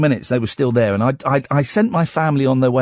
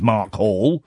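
ordinary delivery

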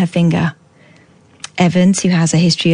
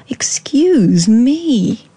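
posh whisper; 5 semitones higher, much slower than usual. Retold or imitated. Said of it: "in the poshest voice".

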